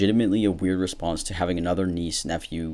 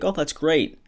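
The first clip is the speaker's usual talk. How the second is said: much higher, chirpy "Oh, that's great"; nice, pleased reply; 10 semitones higher, slower than usual. Pretend. Showing how to answer a pregnancy announcement, high and cheerful. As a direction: sound happy for her. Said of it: "you could be like".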